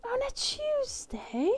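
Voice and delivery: high, slow, quiet British squeak; drawn-out high British accent, softly incredulous; soft mock British. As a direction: echo it in a small British falsetto. Repeats the British line softly.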